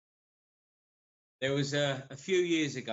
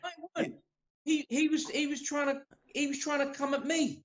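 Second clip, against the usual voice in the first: much higher-pitched objection; real insistent protest. Real, not acted. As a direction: cut in and contest the story, pitch way up. Disputes friend's version of the fight.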